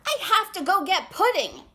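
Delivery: high, loud urgent yelp. A narrator's reading. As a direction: yelp it out in a hurry. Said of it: "yelped Jitterbug".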